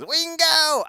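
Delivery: high, loud drawn-out cry; Dale's Wingo. Retold or imitated. Imitating Dale from the cartoon, a long high yell. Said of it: "Whenever Dale says".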